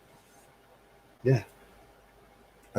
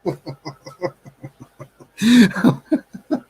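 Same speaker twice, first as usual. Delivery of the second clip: high hearty burst of laughter; loud laugh; 11 semitones higher, 7 dB louder than usual. Real, not acted. Laughing at own joke.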